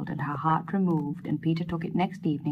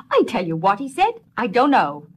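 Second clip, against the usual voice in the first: louder, giving up outright; blurted admission; 4 semitones higher, 7 dB louder than usual. Acted. Peter to the wise woman, a loud blurted admission. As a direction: Peter blurts he doesn't know.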